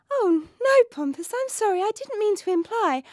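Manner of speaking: high, hurried princess apology; a princess rushing to say sorry; flustered apology. Acted.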